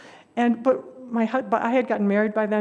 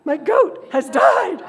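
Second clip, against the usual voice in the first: slow, louder, higher wail rising at the end; hammed-up lament, voice climbing; mock wailing; 6 semitones higher, 8 dB louder, much slower than usual. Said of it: "this wailing and moaning".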